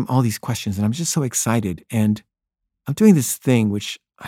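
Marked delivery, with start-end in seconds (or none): none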